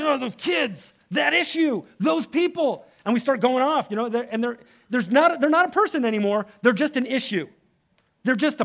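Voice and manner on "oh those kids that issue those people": mock outburst. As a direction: vent like someone worked up online. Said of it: "And you're like".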